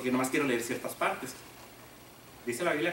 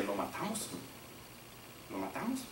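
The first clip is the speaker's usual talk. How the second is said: conspiring hush; 6 semitones lower, 8 dB quieter than usual. Acted. Brothers plot to kill Joseph.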